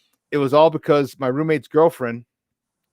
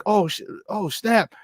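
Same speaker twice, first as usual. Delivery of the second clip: starstruck; 7 semitones higher than usual. Retold or imitated. Starstruck on seeing Rosie.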